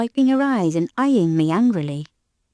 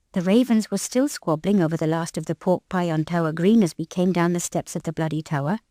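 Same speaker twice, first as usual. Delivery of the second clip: plain reading voice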